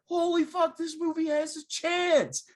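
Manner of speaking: like you just got hooked by the opening; amazed. Retold or imitated. Friend retelling his reaction to a movie intro, excitedly amazed.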